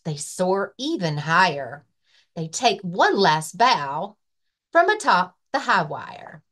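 lively, sing-song picture book reading; reading to children. Acted. Librarian reading a rhyming book to children, lively. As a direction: read it like story time for little kids. Library story time read-aloud.

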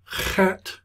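"Chet" with a rasped, heavy H at the start; heavier H. Shown as a demonstration. Teacher naming Hebrew letters, "Chet" with a heavy throaty H. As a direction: open "Chet" on a throaty ch, as in Bach. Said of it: "so it's a heavier H".

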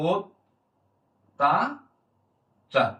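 ও, তা, চার in separate pieces with long pauses; ও-sound demonstration. Shown as a demonstration. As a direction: sound out "o... ta... char" for a learner. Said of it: "এজন্য ও লিখবো".

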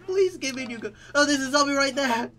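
high, loud frantic begging; shouting in real alarm; panicked pleading. Real, not acted. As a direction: beg the game for a better gun.

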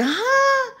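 very high-pitched, mock-confused outburst; acted bafflement. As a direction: act totally lost by the clue. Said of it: "I'd be like".

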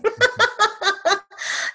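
high-pitched giggling; real amused laugh, high in pitch; laughter. Real, not acted. Woman named tech guru by colleague, amused laughter.

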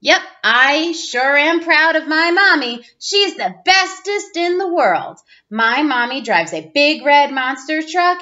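ordinary delivery

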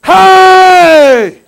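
long, high hearty shout; faked enthusiasm. Shown as a demonstration. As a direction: belt it out to pump yourself up. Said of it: "fake it".

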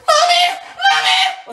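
put-on high desperate shriek of a scared kid; child crying for mommy. Kid in trouble calls out to mom, shrieking. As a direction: scream for mom like a panicked little child. Calling mom after dropping host.

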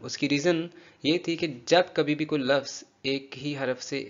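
ordinary delivery

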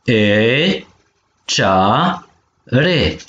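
cea, re with a gap between; syllable by syllable. Shown as a demonstration. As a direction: model cea - re in two held beats. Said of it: "Pene vitumen palalman de acana". (pe silabe: cea, re)

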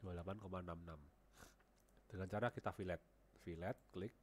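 ordinary delivery

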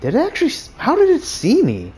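panicked high yelling; freaking out, voice shooting up; panicking gamer. Real, not acted. Enemy suddenly chasing, panicked yelling.

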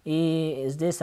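usual speaking voice

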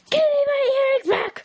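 very high put-on squeal of outrage; mock furious demand. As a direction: shriek the demand in play anger. Acted quarrel over earrings.

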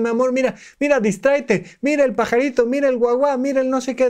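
high, sing-song coaxing voice; cooing at a child. Retold or imitated. Adult soothing a girl with a scraped knee, high sing-song coaxing.

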